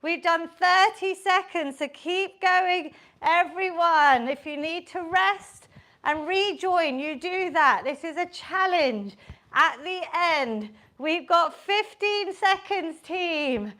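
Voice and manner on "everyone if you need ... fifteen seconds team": normal talking voice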